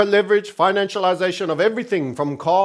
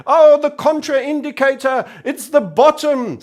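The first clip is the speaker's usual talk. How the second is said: mocking commenters; 6 semitones higher than usual. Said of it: "they say".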